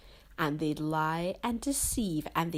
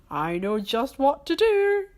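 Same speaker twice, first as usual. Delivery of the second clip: high, loud gleeful villain; cartoon villain cackle-voice; gloating Grinch; 8 semitones higher, 8 dB louder than usual. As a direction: crow over your clever plan.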